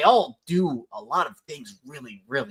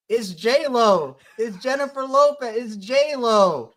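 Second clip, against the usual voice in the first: high, thrilled reveal; excited announcement; 9 semitones higher, slower than usual. Real, not acted. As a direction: sell the nickname big. Hyped nickname announcement.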